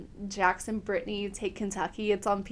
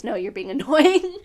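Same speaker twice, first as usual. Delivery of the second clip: louder teasing line that breaks into a laugh; laughing tease; 9 dB louder than usual. Retold or imitated. Nina teasing Danny at dinner, laughing through it. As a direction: scold playfully through a giggle. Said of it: "I think she says".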